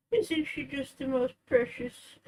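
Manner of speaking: normal talking voice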